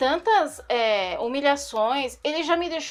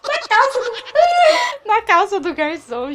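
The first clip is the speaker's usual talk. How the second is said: high burst of laughter mid-phrase; real laughter breaking in; laughing; 8 semitones higher, 6 dB louder than usual. Real, not acted. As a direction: crack up as you say it. Laughing while telling vomit moment.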